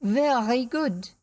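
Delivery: "Very" with a long trilled R; angrily rolled R's. A narrator's reading. Rene angry before the duel, rolling the R of "Very". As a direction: draw out "Very good", rolling the R in "Very". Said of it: "the way he rolled his R's".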